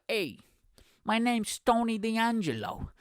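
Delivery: high, drawn-out Italian-American wrestler voice; slow, exaggerated Italian-American accent; Tony D'Angelo. Podcaster mimicking a wrestler's intro vignette, Italian-American accent. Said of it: "This is how he sounded in twenty twenty-one".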